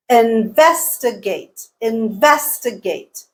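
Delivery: investigate slowed, VES long and strong; syllable stress. Shown as a demonstration. Teacher quizzing learners on stress, investigate stressed on VES. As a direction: draw out investigate, leaning on VES. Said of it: "pick out which syllable is stressed".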